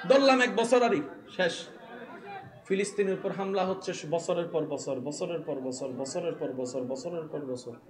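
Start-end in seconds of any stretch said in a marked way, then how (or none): 2.7-7.8 low voice fading softer with each repeat; weary repetition. Real, not acted. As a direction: let it sink lower and softer, year upon year. Repeats phrase for endless attacks. (ক্রমশ নিচু হয়ে আসা গলা)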